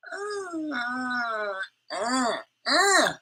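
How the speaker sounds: high, strained real sob sounds; the voice cracks into a cry; crying break. Real, not acted. Ranting vlogger chokes up into real sobs.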